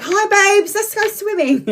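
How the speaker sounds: high, bright put-on voice; camp mock greeting. Teasing her nephew in a sing-song high voice. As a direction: chirp it like a playful camp friend. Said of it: "I'm like".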